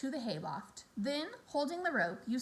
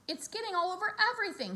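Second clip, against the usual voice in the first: shouting; 9 semitones higher, much faster than usual. Acted. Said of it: "shouted Fern".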